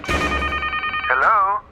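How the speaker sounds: high, bright-pitched woman's hello on the phone; wife picking up her husband's call, high voice; wife answering phone. Acted.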